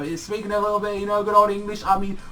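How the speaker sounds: ordinary delivery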